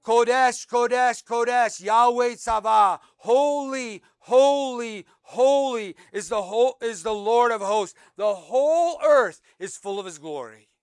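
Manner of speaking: booming seraph cry of holy, holy, holy; angelic proclamation. Acted. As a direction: call it out like seraphim crying across heaven. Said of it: "the one called the other and said".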